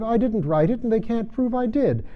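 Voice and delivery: high, anxious denial; pleading knave. A narrator's reading. Knave to the king, nervously denying the letter. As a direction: insist nervously you're innocent.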